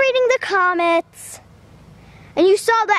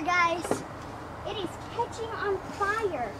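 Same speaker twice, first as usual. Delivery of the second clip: hushed narration; 13 dB quieter than usual. Real, not acted. Vlogger narrating quietly while burning the box, softer. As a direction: talk softly over the fire.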